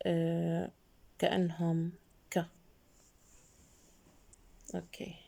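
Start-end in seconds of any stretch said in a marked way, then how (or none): 2.3-2.5 "ك" as a short k sound; letter sound demo. Shown as a demonstration. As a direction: sound out "ك" as a quick "kuh". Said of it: "الحرفين ال سي كي بنلفظهم".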